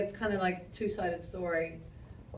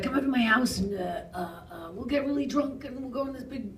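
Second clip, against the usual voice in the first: lightly imitated, higher-pitched excited invitation; excited host; 5 semitones higher than usual. Director inviting stunt women over, excited and rambling. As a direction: play an eager host pitching a night out.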